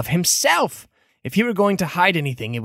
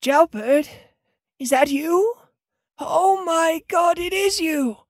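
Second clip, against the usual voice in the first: astonished cleric; 10 semitones higher than usual. Acted. Cleric spotting a lost friend in the cell, astonished. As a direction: gasp of recognition in a high character voice.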